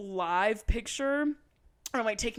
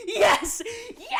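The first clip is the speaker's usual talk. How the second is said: high, loud shout through a laugh; screaming yes; 12 semitones higher, 7 dB louder than usual. Retold or imitated. Fan answering the drag queen, screaming with laughter. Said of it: "I was, like, screaming my ass off".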